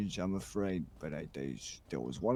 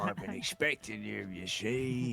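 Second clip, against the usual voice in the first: villain persona voice; 2 semitones higher than usual. Acted. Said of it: "what's that persona called".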